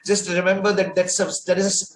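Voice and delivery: ordinary talk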